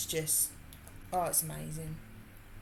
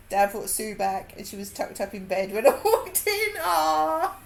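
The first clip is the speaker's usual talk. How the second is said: normal talking voice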